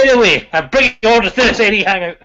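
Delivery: a loud, high, mock-stern Scottish accent. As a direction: call the meeting to order like a hammed-up Scot. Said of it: "You're an extra Scottish".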